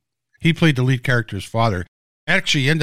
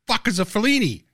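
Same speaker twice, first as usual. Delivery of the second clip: high, bewildered cry of confusion; mock panicked outburst; 10 semitones higher than usual. Retelling how he reacted on set, a high mock-panicked outburst. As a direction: play the clueless newcomer blurting it out. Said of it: "I was panicking".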